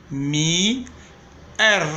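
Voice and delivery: "Mi" held long, then "R" apart; sounding out. Shown as a demonstration. Teacher showing the I then R, "Mi R" sounded out slowly. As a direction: stretch "Mi", pause, then sound "R" separately. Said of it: "Acá se suena la I y después la R".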